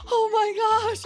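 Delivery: high, loud exasperated outburst; upset, mock panic. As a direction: imitate someone loudly freaking out. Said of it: "he's so upset".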